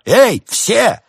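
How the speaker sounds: loud, high shout to all; piercingly high summoning yell; calling out. A narrator's reading. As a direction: holler for everyone to come. Dealer calls everyone over.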